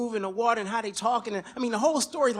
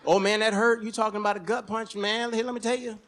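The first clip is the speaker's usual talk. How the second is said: normal talking voice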